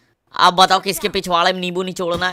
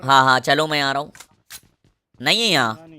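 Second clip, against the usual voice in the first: ordinary talk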